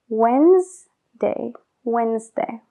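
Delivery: Wednesday slowly as wenzday, no D. Shown as a demonstration. Teacher rebuilding Wednesday from when plus S and day. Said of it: "le añades la S y day".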